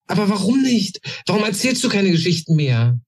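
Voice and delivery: put-on eager young goblin. A narrator's reading. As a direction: press him hard for an answer. Goblin pleads with old sage. (viel höher, drängender kleiner Kobold)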